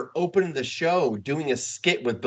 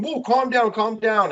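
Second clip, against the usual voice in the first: higher-pitched mock "settle down" plea; imitated calming protest; 8 semitones higher, 3 dB louder, slower than usual. Retelling a TV host facing angry wrestlers, imitated protest.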